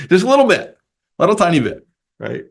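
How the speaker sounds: lightly hammed-up wheedling; playful minimizing. Coaxing with the dog poop offer.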